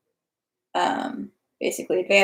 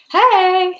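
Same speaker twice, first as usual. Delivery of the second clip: bright call, higher and louder; excited hello; 11 semitones higher, 7 dB louder than usual. Real, not acted. Calling out hello to her mom in the chat, excited.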